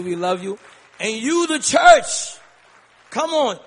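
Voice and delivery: normal talking voice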